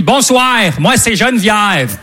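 put-on thick, drawn-out Québécois accent; Quebec accent. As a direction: imitate a woman's broad Quebec accent. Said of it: "je connaissais pas l'accent québécois". (accent québécois caricatural)

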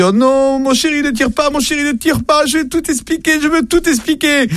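high pleading wife's voice, frantic; panicked wife. Acted. Wife caught in bed begging husband not to shoot, high and frantic. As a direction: beg him not to shoot in a high squeal.

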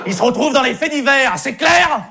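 put-on loud threatening shout at the kids; threat shout. Camp monitor threatening the kids, loud shout. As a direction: bark it out so nobody dares move.

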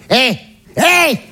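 high mum voice, nudging a stranger; imitated mother. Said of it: "Je la vois qui fait au passage à côté". (maman imitée qui interpelle, voix aiguë)

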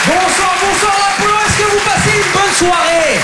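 calling out to the crowd. Real, not acted. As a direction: shout hello to a whole theatre. Stand-up greets the crowd.

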